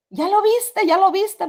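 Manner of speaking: high, loud frightened urging; begging her to see it too; dying husband urging his wife to look, terrified. Retold or imitated. Husband panics at vision.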